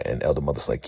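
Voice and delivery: ordinary delivery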